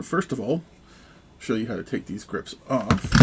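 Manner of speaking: usual speaking voice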